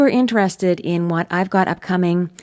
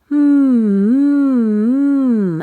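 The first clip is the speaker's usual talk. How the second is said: loud, higher hums; resonant hum; 6 semitones higher, 6 dB louder, much slower than usual. Shown as a demonstration. Coach humming for students to feel the resonance. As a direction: hum strongly into the mask. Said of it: "feel the sound kind of going off the roof".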